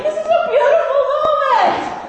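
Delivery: high and loud, laughing through emotion; teary laughing exclamation. Retold or imitated. Moved preacher at dinner telling friends, laughing high exclamation. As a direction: exclaim it laughing with tears in your eyes. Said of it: "I get tears in my eyes".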